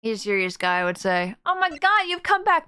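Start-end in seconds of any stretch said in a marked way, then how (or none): 1.5-2.7 high-pitched thrilled exclamation; excited outburst. Pretend. Fan greeting a returning game character, high and excited.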